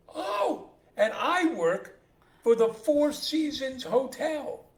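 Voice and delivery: very high, loud, slow astonishment; drawn-out, hammed-up marvelling; acted amazement. Third father hears he has quadruplets, amazed.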